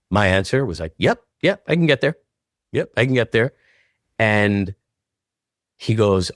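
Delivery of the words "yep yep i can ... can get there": quick, higher eager yep yep; eager yes. Retold or imitated. Answering Showtime's offer on the phone, eager and excited. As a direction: jump at the offer, pitch up high.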